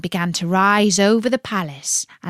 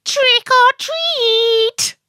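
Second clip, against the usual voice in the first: very high, slow, loud sing-song call; little witch calling out; 5 dB louder, much slower than usual. Acted. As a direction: call it out like a tiny trick-or-treater. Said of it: "one of the little witches called out".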